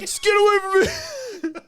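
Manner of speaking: very high, slow, laughing panic; mock terrified cry. Mime fleeing the hero, very high laughing panic. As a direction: wail it like a scared mime.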